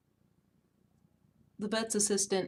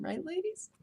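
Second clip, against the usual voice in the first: conspiratorial whisper to the ladies; whispered aside; 8 semitones higher, 7 dB quieter than usual. Real, not acted. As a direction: lean in and whisper it. Aside to female viewers.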